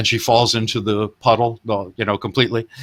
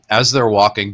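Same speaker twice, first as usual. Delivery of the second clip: usual speaking voice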